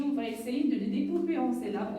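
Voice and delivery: ordinary delivery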